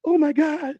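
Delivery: high, loud mock scream of pain; imitating a scream. Man mimicking his sick date, loud mock scream. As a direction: yelp it like she's in agony.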